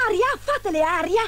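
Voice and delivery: high-pitched dwarf in alarm; urgent exclamation. A narrator's reading. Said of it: "esclamò il secondo".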